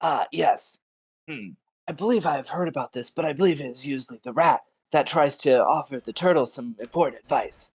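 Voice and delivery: lofty, measured wise-man voice; a self-important sage, lightly voiced; pompous scholar voice. Voicing his character.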